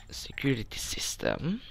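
whispered, reading the label. Real, not acted. Sneaking player whispering what the screen shows. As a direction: breathe it out under your breath.

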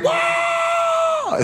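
high cheering shout of the crowd; fans cheering. Retold or imitated. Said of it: "the fans would go".

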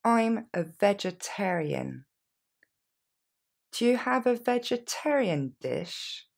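slow, careful teacher delivery; slowed-down model sentence. Shown as a demonstration. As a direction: spell it out slowly for learners. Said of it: "Plus lentement".

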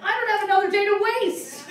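high, loud, laughing snap back; exasperated reply laughing through it; mock exasperated. To the guy asking for another photo, a loud laughing retort. Retold reply to sext request.

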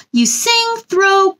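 usual speaking voice

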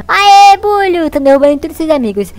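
high, loud whoop of victory; triumphant cheer. Real, not acted. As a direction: cheer like you just won. Knocks enemies down, cheering. (grito de vitória alto)

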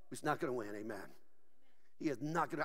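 low, soft aside; quiet assurance. Real, not acted. Preacher assuring the church evil will lose, quietly. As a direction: drop right down, low and hushed. Sudden hush after shouted climax.

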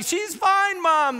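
put-on high, loud teen voice; daughters brushing off. Daughters telling their worried mother she's fine. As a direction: wave off mom's worry. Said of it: "Bailey and Bianca are like".

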